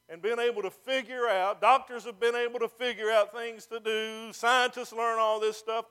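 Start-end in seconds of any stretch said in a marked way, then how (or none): none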